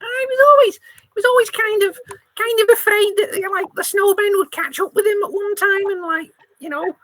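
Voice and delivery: high, quick mouse voice; a scared little mouse spilling rumors; squeaky mouse. Acted. Mouse sending the detective off, high and squeaky.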